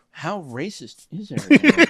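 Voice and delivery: mock indignant disbelief; mock appalled. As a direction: play a scandalised diner. Voicing shocked diners at other tables.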